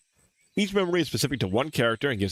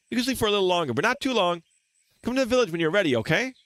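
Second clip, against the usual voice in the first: higher, lilting character voice; cheerful friend waking sleeper; 9 semitones higher, faster than usual. Acted.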